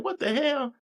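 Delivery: retold shocked what the hell; high-pitched disbelief; incredulous. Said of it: "And I was like".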